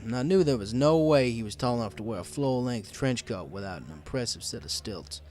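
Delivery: plain reading voice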